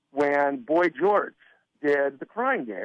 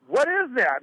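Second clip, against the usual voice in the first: pitched-up mock demand; irate boss; 9 semitones higher than usual. Boss asks about the song playing, high mock indignation.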